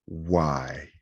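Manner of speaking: slow, soft, mildly dejected mutter; regretful self-questioning. Retold or imitated. Comic to himself walking off stage, regretful. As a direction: ask yourself quietly, drawn out.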